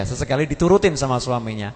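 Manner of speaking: normal talking voice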